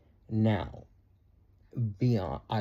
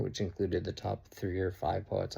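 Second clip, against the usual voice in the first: ordinary talk, nothing marked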